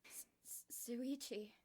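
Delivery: whispered, stammering the name; breathy stammer on the name; stuttering whisper. Acted. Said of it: "I heard Kokichi stutter".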